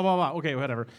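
ordinary delivery

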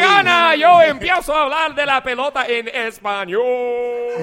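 loud, high excited Spanish play-by-play shout; Spanish baseball announcer. Pretend. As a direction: belt it like a Spanish-language sportscaster. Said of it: "go into Spanish baseball voice".

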